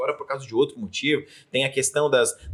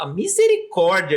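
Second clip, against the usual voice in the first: religious person's exclamation; 6 semitones higher, 7 dB louder than usual. Retold or imitated. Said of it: "no processo religioso".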